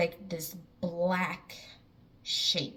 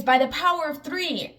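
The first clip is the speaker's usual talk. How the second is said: high, raised mock yell; half-scream, pitched up high; retold scream to kids; 8 semitones higher, 5 dB louder than usual. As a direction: call it out urgently to the kids. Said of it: "I scream to my kids".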